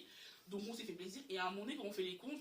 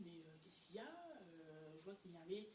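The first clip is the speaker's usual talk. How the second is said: much softer, low, concerned murmur; friend's gentle concern; 5 semitones lower, 15 dB quieter than usual. Retold or imitated. Friend Laurine taking her aside, soft and concerned. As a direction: quietly ask what's wrong. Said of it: "Laurine me prend sur le côté".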